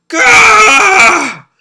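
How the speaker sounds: a sudden high, loud scream of grief; anguished scream. Acted. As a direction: let out a raw wail of despair. Shiro screams over Yuri's body.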